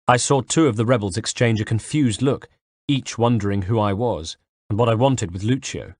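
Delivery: ordinary delivery, nothing marked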